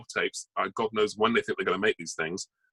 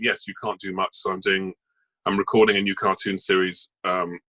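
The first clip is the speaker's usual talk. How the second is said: usual speaking voice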